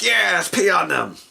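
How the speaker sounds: loud, high cheer of a goblin mob; rowdy cheer. Acted. Goblins answer their leader's speech, rowdy cheer. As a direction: cheer the leader on at the top of your voice.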